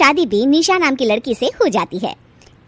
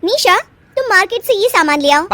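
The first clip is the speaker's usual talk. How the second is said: bossy sister-in-law; 8 semitones higher, 4 dB louder than usual. Acted. Elder sister-in-law orders.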